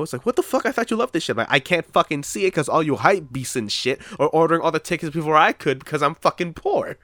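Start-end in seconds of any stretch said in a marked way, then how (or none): 0.3-1.4 high-pitched, incredulous challenge; mock disbelief. Friends asking why he has not seen Endgame, mock disbelief. 1.4-7.0 higher-pitched, mildly exasperated rant; exasperated retort. Retold or imitated. Fan answering friends about sold-out tickets, comic exasperation.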